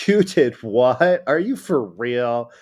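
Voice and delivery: loud, drawn-out mock disbelief; acted incredulous outburst; rhetorical question. Shown as a demonstration. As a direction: ask it loudly and slowly, as if appalled. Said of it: "It's supposed to be obvious".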